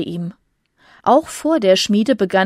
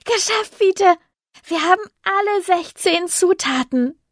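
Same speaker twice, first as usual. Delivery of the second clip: jubelnd; 11 semitones higher than usual. Acted. Tizi jubelt.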